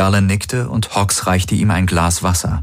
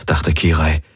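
ordinary delivery

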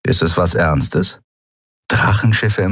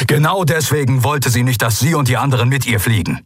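higher, heated bark; barked retort; 7 semitones higher than usual. A narrator's reading. Telios explains her decision to Kai, barking. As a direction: bark it back in anger. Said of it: "bellte Telios".

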